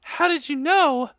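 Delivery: high-pitched theatrical astonishment; hammed-up amazed question; surprised. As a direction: gasp the question in mock surprise. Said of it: "I asked in a surprised way".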